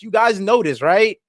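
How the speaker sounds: ordinary talk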